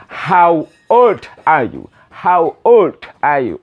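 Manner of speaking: put-on slow, careful teacher model with stress on old; drawn-out classroom drill; slow model phrase. Shown as a demonstration. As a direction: say each word slowly for the class to repeat. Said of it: "Repeat after me".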